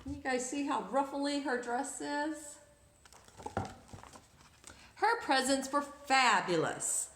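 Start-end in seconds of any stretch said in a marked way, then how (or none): 5.0-7.1 lively read-aloud storytelling; story narration. A narrator's reading. Storyteller reading to children, lively narration. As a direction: read it like a picture book to kids.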